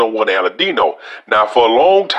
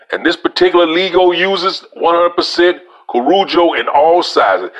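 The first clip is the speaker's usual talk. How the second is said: reading aloud; 5 semitones higher than usual. Acted. Reviewer reading a cigar website to viewers, raised reading voice. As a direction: read the website out like an announcement.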